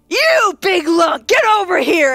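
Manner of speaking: loud, high, drawn-out hail; boisterous shout. Acted. Dragonborn greeting a friend before a bear hug, boisterous shout. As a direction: holler it heartily at an old friend.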